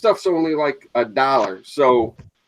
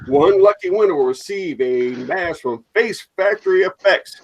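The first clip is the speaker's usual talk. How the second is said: normal talking voice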